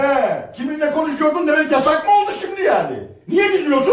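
angry shout. Acted. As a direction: demand to know who she was talking to. Husband quarrels with wife.

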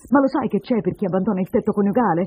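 loud, high, menacing harangue; yelling. Acted. Woman threatens deserting husband with prison, yelling. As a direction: threaten him, pitch climbing on the last cry. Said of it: "mi urlava sotto il naso".

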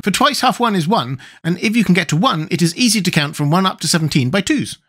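high character voice working out a sum; Wogglebug, pedantic. Acted.

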